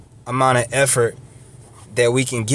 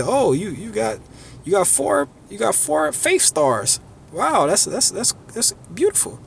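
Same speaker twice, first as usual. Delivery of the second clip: mock admirer; 5 semitones higher, 4 dB quieter than usual. People admiring imaginary faith stars.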